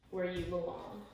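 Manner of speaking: usual speaking voice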